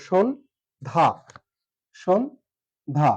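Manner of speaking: সন্ধ্যা broken as শোন then ধা, with a pause between; split into syllables. Shown as a demonstration. Teacher to students, syllables spoken separately. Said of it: "এটা হচ্ছে সঠিক উচ্চারণ".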